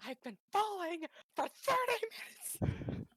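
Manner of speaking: high, strained mock complaint; mock outraged cry. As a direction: cry it out in hammed-up indignation. Quotes a movie line as a joke.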